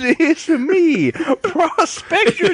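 put-on high, slow, laughing old prospector; cackling old gold prospector. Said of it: "Prospector Jimmy".

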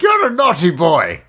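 mock-stern nun's reprimand; hammed-up telling-off; scolding nun. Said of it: "be like".

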